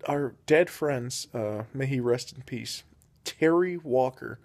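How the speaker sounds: ordinary delivery, nothing marked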